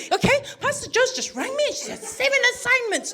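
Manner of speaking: high-pitched mock telling-off; exasperated scolding. Mother scolding son, retold.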